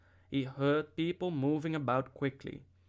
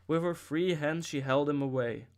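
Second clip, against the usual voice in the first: ordinary delivery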